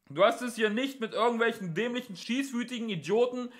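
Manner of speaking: high, loud policeman's holler; shouted, condescending warning; megaphone voice. A narrator's reading. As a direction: blare it out as if through a megaphone. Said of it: "sagte die Megafonstimme".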